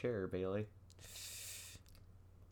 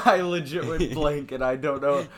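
loud laugh spilling into words; 4 semitones higher, 8 dB louder than usual. Real, not acted. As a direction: laugh through the line. Laughing after going blank.

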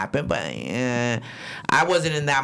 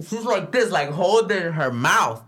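usual speaking voice

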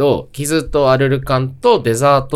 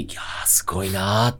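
awed whisper; 6 semitones lower, 8 dB quieter than usual. Real, not acted. Recalling the fans' headbanging.

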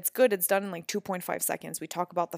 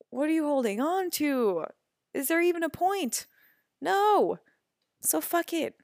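high, emphatic rhetorical push; rising pep talk; 7 semitones higher, 3 dB louder than usual. Real, not acted. Host urging listeners to drop it, high and emphatic. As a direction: pump the listener to let go.

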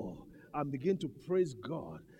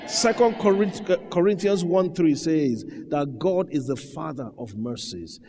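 full-force preaching; 7 dB louder than usual. Acted. Preacher citing Corinthians to the church, full-force preaching. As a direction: ring the verse out over the congregation.

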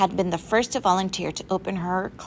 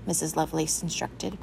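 ordinary delivery

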